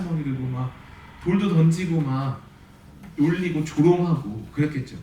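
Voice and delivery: normal talking voice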